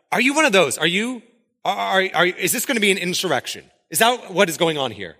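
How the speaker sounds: high-pitched, urgent acted interrogation; alarmed questioning. Pilate questioning Jesus about an insurrection, alarmed. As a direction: fire off suspicious questions, stumbling over them.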